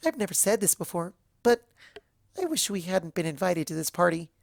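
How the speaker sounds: subdued Pinkie. A narrator's reading. Pinkie Pie admitting regret, mildly downcast. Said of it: "Her ears folded back".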